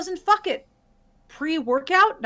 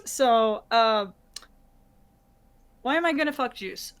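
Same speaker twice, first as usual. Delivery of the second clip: ordinary talk, nothing marked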